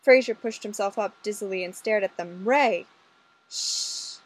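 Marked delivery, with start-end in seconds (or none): 2.5-2.9 louder sharp call of a name; alarmed call. Acted. Fraser calling out to Ray in sudden alarm. 3.5-4.2 unvoiced sharp shush; hissed shush. Olga hushing Ray under the desk, a hissed shush. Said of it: "Olga hissed".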